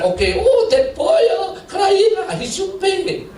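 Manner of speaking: high, broken-English shop clerk; mock the fretting clerk; imitating clerk. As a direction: clerk complaining about the boy. Clerk voiced in story.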